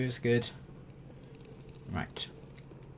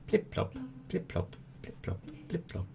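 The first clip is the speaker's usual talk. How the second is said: water drip; 10 semitones higher, 6 dB quieter than usual. Retold or imitated. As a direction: tap out dripping water softly. Imitating dripping sounds.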